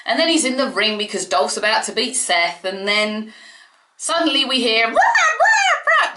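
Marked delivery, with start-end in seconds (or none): 4.9-6.1 high, loud cawing crow; terrible crow impression. Vlogger imitating the crow call before Sting, badly cawing. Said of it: "That was a terrible crow impression".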